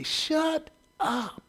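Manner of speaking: high, soft retort; a muttered scolding snap; retold shut up. Dad at the TV president, high soft retort. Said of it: "And I would say".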